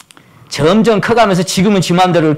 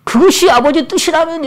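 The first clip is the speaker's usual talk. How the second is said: normal talking voice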